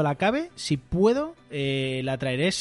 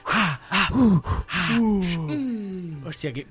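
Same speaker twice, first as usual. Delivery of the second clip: squirm with impatience, then blurt it out; excited whimpering; 3 semitones higher than usual. Real, not acted. Host longs for the second season, in excited whimpers.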